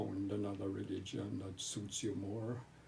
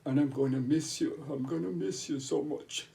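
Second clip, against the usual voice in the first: higher and louder, fighting back tears; tearful goodbye; 5 semitones higher, 7 dB louder than usual. Real, not acted. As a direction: voice rising and breaking with tears. Farewell to a dying brother.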